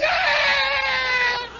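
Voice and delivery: put-on high, wailing kid's yell; kid's shrill shout. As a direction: let out a child's protesting cry. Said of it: "And the kid".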